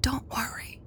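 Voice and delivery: high, very soft whisper; secretive, gentle hush. A narrator's reading. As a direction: lean in and whisper reassurance. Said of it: "She leaned over and whispered".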